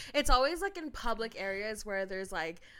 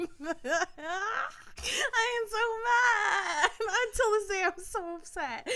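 helpless laughter; 10 semitones higher than usual. Real, not acted. Host claims to be upset at co-host, helpless laughter. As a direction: squeal it out while cracking up.

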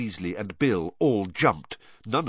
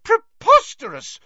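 very high, loud explosion; shrill blustering shout; outraged shout; 10 dB louder than usual. A narrator's reading. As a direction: burst out in disbelief. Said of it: "shouted Fudge again".

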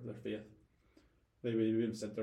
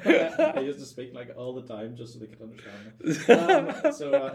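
louder, laughing through the words; 3 semitones higher, 14 dB louder than usual. Real, not acted. Admitting he spoke Yorkshire for flatmates, laughing. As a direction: chuckle while confessing it.

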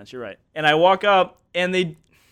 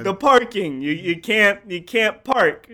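slowed words; 6 semitones higher, 4 dB louder, slower than usual. Retold or imitated. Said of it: "slowing down the words".

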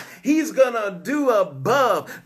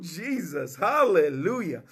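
ordinary talk

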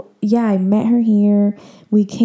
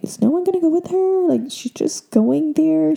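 high-pitched mock-incredulous relatives; imitating concerned family; 8 semitones higher than usual. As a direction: voice fretting relatives, pitched well up. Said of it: "family kept asking".

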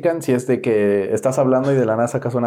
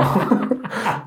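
high, softer laugh; 7 semitones higher, 4 dB quieter than usual. Real, not acted. Friend cracking up while telling on himself, high laugh. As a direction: break into a high laugh mid-thought.

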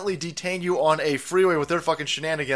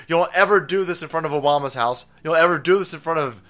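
normal talking voice

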